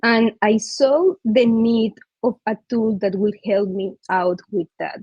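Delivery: usual speaking voice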